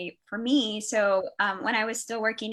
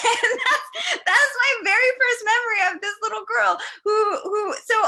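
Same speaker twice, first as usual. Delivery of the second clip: high, loud, laughing delight; 11 semitones higher, 7 dB louder than usual. Real, not acted. Telling a fond first memory of a little girl, laughing. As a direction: talk through real laughter, pitch way up. Laughing after retelling child's dragon boast.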